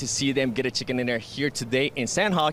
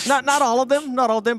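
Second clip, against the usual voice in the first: playful protest; 11 semitones higher, 5 dB louder than usual. Real, not acted.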